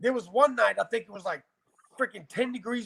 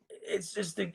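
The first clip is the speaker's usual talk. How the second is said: choked-up whisper; 9 dB quieter than usual. Real, not acted. Choking up telling story.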